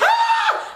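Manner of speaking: high, sudden "ah" scream; short, piercing yelp of labour pain; re-enacted scream. Mother re-enacts screaming during her labour, high scream. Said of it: "screaming. My, I was like".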